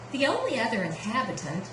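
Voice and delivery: usual speaking voice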